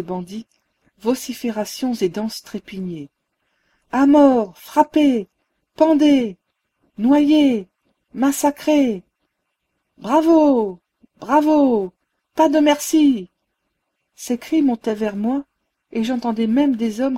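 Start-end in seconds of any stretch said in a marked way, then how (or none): 3.9-9.0 cris de foule enragée, plus aigus et bien plus forts; cris sauvages. A narrator's reading. Cris de la foule des bandits. 10.0-13.3 acclamer fort et haut, avec délire; acclamations sauvages. A narrator's reading. Foule acclamant le massacre.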